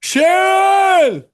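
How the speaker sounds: high shouted call of a name; [name]. Retold or imitated. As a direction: yell it the way Ozzy does. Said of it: "I said Sharon the way Ozzy says Sharon".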